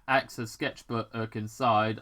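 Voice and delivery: ordinary delivery, nothing marked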